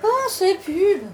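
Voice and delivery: slow, drawn-out weary sigh of a line; exasperated. Acted. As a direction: drag it out, fed up. Fed up with the ads.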